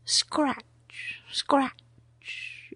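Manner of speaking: whispered, slow, barely there; faint whisper. A narrator's reading. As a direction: breathe the words like a tiny sound. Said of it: "the faintest scratch".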